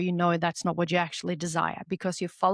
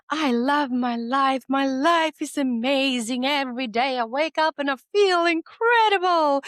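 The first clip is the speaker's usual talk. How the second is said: mock cheerful mind; 6 semitones higher, 3 dB louder than usual. Mind pretending all is well, sing-song and high. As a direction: sell the forced happiness, higher and louder. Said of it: "your mind is gonna be like".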